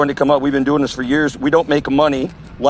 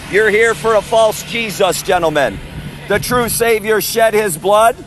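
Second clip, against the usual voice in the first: steady raised preaching voice; street preaching; 4 semitones higher than usual. Real, not acted. Street preacher calling out to festival goers, preaching. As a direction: proclaim it to the crowd.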